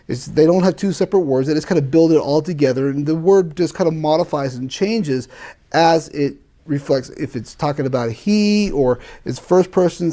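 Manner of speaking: normal talking voice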